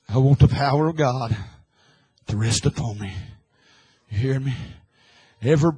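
ordinary talk, nothing marked

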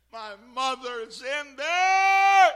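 high, very loud scream with a long held last word; retold scream. Held-down daughter fighting to reach her mother, screaming. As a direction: scream it out desperately, stretching the end. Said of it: "she shook and fought and screamed".

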